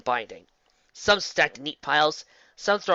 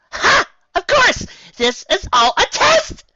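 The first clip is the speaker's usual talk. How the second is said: very high, loud, slow triumph; high-pitched eureka shout; triumphant eureka; 12 dB louder, slower than usual. Acted. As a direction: crow it out in delight. Triumphant realization.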